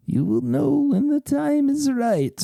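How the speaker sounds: high, slow mystic voice, voiced not whispered; a hammed-up seer's hush; fortune teller whisper. Fortune teller answers the player's question, slow and high. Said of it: "the fortune teller whispers".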